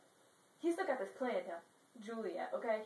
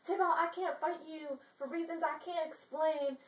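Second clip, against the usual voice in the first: mock earnest plea; 6 semitones higher than usual. Romeo pleading not to fight Tybalt.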